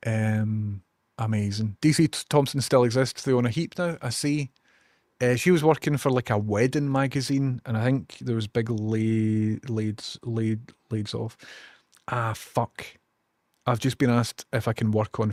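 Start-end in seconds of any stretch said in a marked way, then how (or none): none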